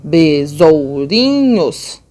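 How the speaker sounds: BESOURINHOS drawn out, OU in one syllable; vowels said together. Shown as a demonstration. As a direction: stretch BESOURINHOS but keep ou joined. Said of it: "eu acabo falando junto".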